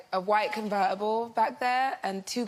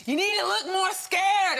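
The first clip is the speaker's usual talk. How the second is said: high, loud, slow mock yell; imitated shouting; 11 semitones higher, 5 dB louder, slower than usual. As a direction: shout it like an insistent director, half laughing. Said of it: "he just keeps shouting at me".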